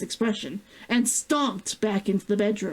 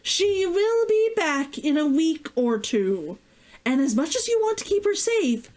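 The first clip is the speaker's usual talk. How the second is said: raised voice; 8 semitones higher than usual. A narrator's reading. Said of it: "he raised his voice".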